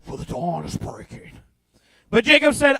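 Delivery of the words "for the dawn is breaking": much lower and softer, grave Lord of the Rings intone; hushed movie-wizard solemnity; epic fantasy voice. Shown as a demonstration. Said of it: "This sounds like a Lord of the Rings movie".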